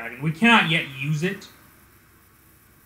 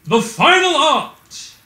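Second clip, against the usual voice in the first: much higher, louder, slow anime attack shout; attack callout; 12 semitones higher, 6 dB louder, much slower than usual. Acted. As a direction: belt the move name like an anime hero. Announcing ultimate attack name.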